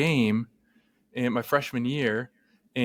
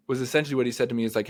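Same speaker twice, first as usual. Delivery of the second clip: usual speaking voice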